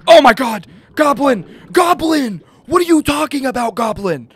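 high, loud frantic outburst; panicked shouting. Real, not acted. Player cornered by the goblin again, panicked shouting. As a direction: shriek at the goblin in disbelief.

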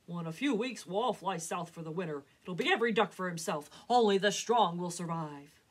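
loud, harsh lecture; stern father. A narrator's reading. Papa to Mama, loud and harsh. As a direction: insist only the strong survive.

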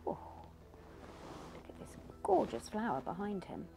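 ordinary delivery

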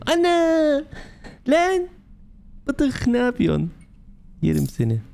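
very high, drawn-out exclamation; high surprised squeal. Real, not acted. Player spotting the dog Bıdık, high surprised squeal. Startled delight at pet dog.

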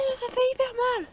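high, loud whiny protest; pained complaint. Acted. A hurt toy figure complains in a high, loud wail.

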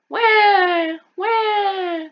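put-on loud high baby crying; baby crying in the crib; baby wail. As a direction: wail like a newborn. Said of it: "the baby will cry".

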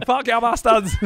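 high, loud, gleeful yelling; wild yell. Retold or imitated. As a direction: yell it like a maniac setting fires.

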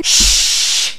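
slow unvoiced hush; urgent shush. Hushing someone mid-greeting, slow urgent shush. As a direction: shush them sharply.

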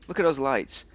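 normal talking voice

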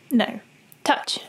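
ordinary delivery

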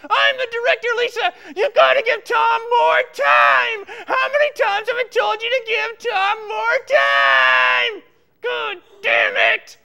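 loud, exasperated tirade; angry shouting. Acted. Director scolding Lisa over Tom's time, furious shouting. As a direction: bawl out an employee at full volume.

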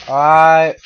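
a fed-up yell; frustrated shout. Real, not acted. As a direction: blow up at the game. Frustrated outburst at the game.

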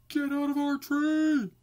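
high, loud angry shout; bumblebees shout. Acted. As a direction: chase them out of the tree. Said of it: "the bumblebees shout".

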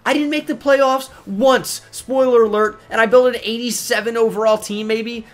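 incredulous rant. Real, not acted. Comparing Texans rebuild to Bengals.

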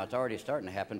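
ordinary delivery

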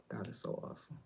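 breathy whisper of the same praise. Shown as a demonstration. Whispering God is so awesome after saying it aloud. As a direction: drop to a whisper.

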